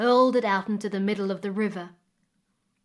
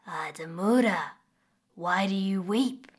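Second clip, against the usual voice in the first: familiar crocodile voice. A narrator's reading. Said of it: "came a familiar voice".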